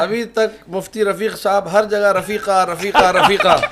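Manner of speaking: loud, laughing tease about the mufti; playful loud jab at a colleague; teasing with laughter. Real, not acted. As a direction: rib him loudly while laughing.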